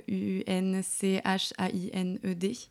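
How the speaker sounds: letters spelled out slowly one by one. Shown as a demonstration. Guest spells the account name slowly, letter by letter, for listeners. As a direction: spell each letter of Unchained slowly and clearly.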